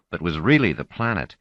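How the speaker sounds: usual speaking voice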